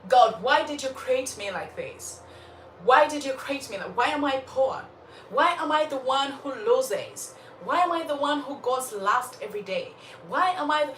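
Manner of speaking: mock self-pitying protest, each "why" pushed; complaining to God. Shown as a demonstration. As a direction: demand answers in a put-upon whine. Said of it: "now this is not a prayer".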